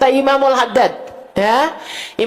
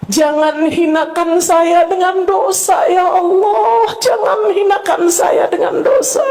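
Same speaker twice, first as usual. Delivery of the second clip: impassioned prayer; 7 semitones higher than usual. Acted. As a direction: cry out to God with full force.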